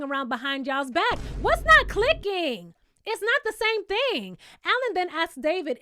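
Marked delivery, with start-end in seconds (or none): 1.4-4.4 higher, slow, exasperated; exasperated scolding. Real, not acted. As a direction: spell it out to him, fed up. Scolding David directly.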